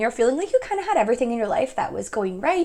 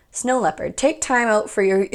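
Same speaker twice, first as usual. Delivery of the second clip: ordinary talk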